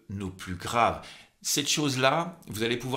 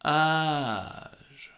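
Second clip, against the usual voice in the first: vocal fry; 3 semitones higher than usual. Shown as a demonstration. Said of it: "Et on redescend".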